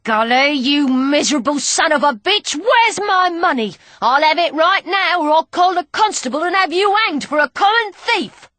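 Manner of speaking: loud, high furious snarl. Acted. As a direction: tear into him for the stolen money.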